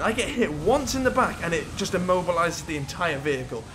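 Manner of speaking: ordinary talk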